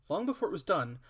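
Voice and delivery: usual speaking voice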